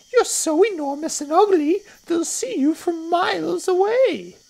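high, loud accusing little croc; not-so-big crocodile. Acted. Not-so-big crocodile accusing the enormous one, high scolding voice. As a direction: scold in a tiny high creature voice.

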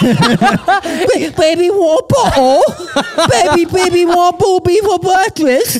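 put-on very high baby-talk British accent. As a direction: imitate a British baby, squeaky and babbling. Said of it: "Yours is like a baby Brit".

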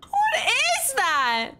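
high shriek then slow gasp; scared scream. Real, not acted. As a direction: scream at the monster. Jump scare in horror game.

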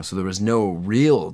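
ordinary talk

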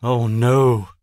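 a witch yelling as her plan fails. Acted. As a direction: yell in frustration. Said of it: "Simma yells".

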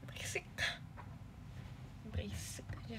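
hushed whispered retort; whispering berisik aja. Real, not acted. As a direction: brush them off in a whisper. Muttered reply to chat.

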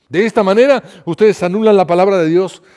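loud, high declaration; forceful rebuke. A narrator's reading. Jesus rebuking the Pharisees over tradition, loud rebuke. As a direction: raise the voice to denounce them.

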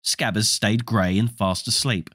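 plain reading voice

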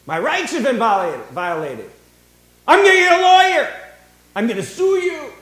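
loud, high, whiny outrage; mock outrage. Preacher imitating someone threatening to sue, loud mock outrage. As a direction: play the offended citizen threatening a lawsuit. Mocking someone protesting to police.

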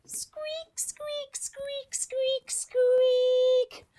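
very high squeaky mouse noises; high-pitched mouse squeaks. A narrator's reading. Mouse approaching.

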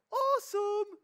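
very high, loud squeal; over-the-top falsetto cheer; mock enthusiasm. Preacher reacting to the Message's cheery wording, mock delight.

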